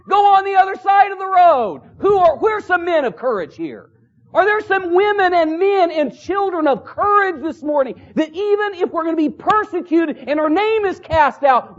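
high, rousing pulpit call; forceful preaching. Acted. Exhorts the congregation.

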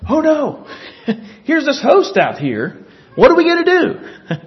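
high-pitched mock panic, half laughing; hammed-up alarm with amusement showing through; panicked servant. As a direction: play a flustered servant bursting in with news. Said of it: "Here comes the servant running in the door".

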